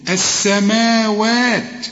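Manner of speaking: السماوات with both alif vowels stretched slowly; drawn-out long vowels. Shown as a demonstration. Teacher showing students where to lengthen, السماوات drawn out slowly. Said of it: "علشان تمد المدود".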